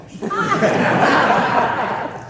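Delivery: a loud, high laugh at her own punchline; a speaker cracking up at her story; real laugh. Real, not acted.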